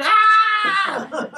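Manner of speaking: very high, loud mock shriek; piercing imitation of a woman's scream; imitated scream. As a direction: let out an overwhelmed shriek. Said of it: "And she screamed again".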